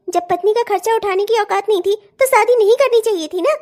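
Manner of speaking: normal talking voice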